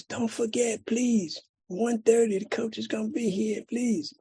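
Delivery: voiced kid, earnestly imploring; pleading son. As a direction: plead with Dad to remember. Son begging father to remember.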